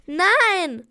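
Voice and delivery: a loud, high, curt shout. Acted. Girl yelling at her rival Lisa while running. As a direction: snap it back and run. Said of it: "schrie ich".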